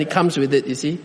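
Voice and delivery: ordinary delivery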